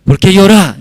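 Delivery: high, loud acted question; urgent question. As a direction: ask in alarm, playing the caller. Acts urgent question on phone.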